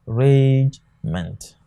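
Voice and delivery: "Ragement" slowly split as rage-ment; syllable by syllable. Shown as a demonstration. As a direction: stretch "Ragement" into two separated syllables, teaching the division. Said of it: "these are the various syllables".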